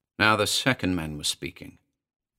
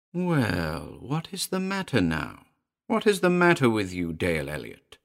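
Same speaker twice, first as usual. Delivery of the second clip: cold little familiar. Acted. Voices the little creature Baroque.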